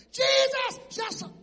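high, loud desperate cry; hollering. Retold or imitated. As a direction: cry out to Jesus for mercy. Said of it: "was hollering".

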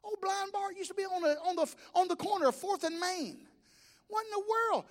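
high, astonished voice; a high-voiced man gaping at blind Bart; amazed onlooker. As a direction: pitch it up in disbelief. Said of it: "He goes".

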